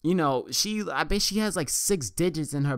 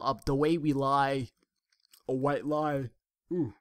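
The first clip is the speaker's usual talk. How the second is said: talking through a yawn. Real, not acted. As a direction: yawn mid-sentence. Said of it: "I don't know why the hell I'm yawning".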